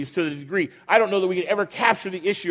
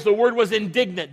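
ordinary talk, nothing marked

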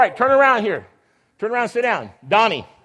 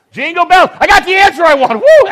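loud, high, triumphant whoop; excited cheer; 9 semitones higher, 12 dB louder than usual. Real, not acted. Leader triumphant as a child finally names Jingle Bells, loud excited cheer. Finally gets the wanted answer.

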